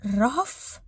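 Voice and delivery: stunned, halting single-word question; with difficulty. Acted. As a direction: force the name out in disbelief. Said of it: "فردوس نطقت بصعوبة".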